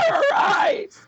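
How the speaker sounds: very high screeching shriek; pterodactyl shriek. Shown as a demonstration. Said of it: "Like that".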